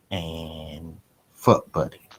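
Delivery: ordinary talk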